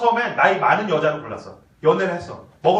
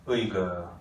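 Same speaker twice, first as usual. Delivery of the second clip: scornful tsk; 9 dB quieter, slower than usual. Shown as a demonstration. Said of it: "따라서 하세요".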